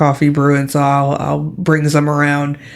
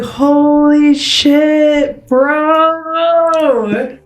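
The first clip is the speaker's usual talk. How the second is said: long, drawn-out high cry of amazement; stunned bro, voice way up high; shocked; 10 semitones higher, much slower than usual. Acted. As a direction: stretch it out in high-pitched disbelief. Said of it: "like, shocked".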